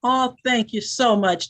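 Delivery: usual speaking voice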